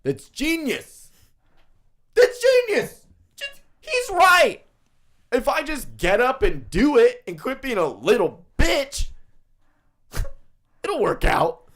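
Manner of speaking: high, loud, gushing mock fan voice; mocking a fanboy. Mocking Gary Vee fans who think he's a genius, gushing high voice. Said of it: "been like".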